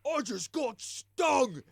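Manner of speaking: high, loud startled troll cry; mock troll yelp. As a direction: yelp it like a stung drunk troll. Drunk troll yelling in shock.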